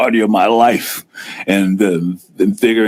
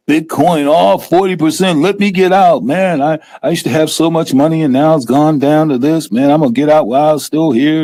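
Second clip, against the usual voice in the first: higher-pitched, slowed panic at the headline; scared seller; 7 semitones higher than usual. Retold or imitated. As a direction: mimic a spooked investor bailing out. Voicing scared sellers after crash.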